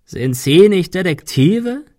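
drawn-out scornful rasp; croaking villain. A narrator's reading. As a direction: croak it mockingly, rising at the end. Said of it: "krächzte er".

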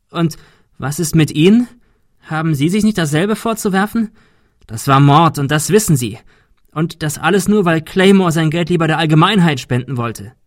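detective confronts the murderer, heated; heated accusation. Acted. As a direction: hurl the accusation at him.

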